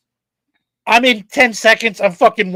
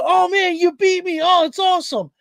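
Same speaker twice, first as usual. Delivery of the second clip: mock innocent son; 7 semitones higher than usual. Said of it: "He reeled me in with the".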